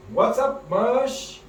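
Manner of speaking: high, bright segment title call; excited announcement. Acted. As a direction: announce the segment like a show host.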